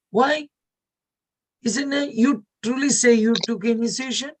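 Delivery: high-pitched questioning "why"; surprised why. Retold or imitated. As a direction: imitate a startled reaction. Mother questioned on his surprise.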